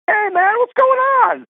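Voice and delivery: very high, drawn-out friendly hello; effusive mock greeting. Broadcaster greeting a total stranger, imitated as high, drawn-out delight.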